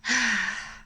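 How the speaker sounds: audible breathy exhale; sigh. Real, not acted. Card reader admiring the deck before asking it, sighing. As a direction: let out a big sigh.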